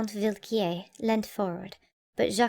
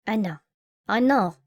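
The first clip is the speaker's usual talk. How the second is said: usual speaking voice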